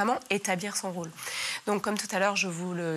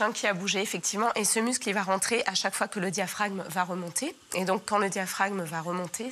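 usual speaking voice

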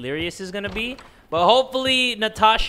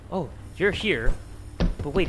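usual speaking voice

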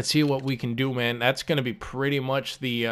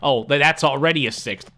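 usual speaking voice

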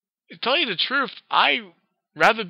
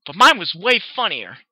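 huffy; 5 semitones higher, 8 dB louder than usual. Acted. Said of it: "Pinky huffed".

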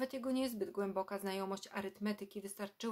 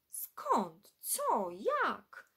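loud, high, tumbling surprised cries; the cousins are stunned to see him; astonished questions; 4 semitones higher, 5 dB louder, much slower than usual. Acted. Aunt's family greets the unexpected visitor with loud, high amazement. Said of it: "zasypywano go pytaniami".

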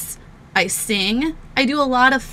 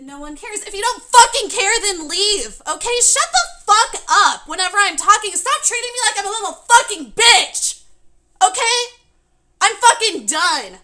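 high, heated outburst; furious and fed up; angry raised voice; 6 semitones higher, 4 dB louder than usual. Real, not acted. Streamer snapping at viewers in chat.

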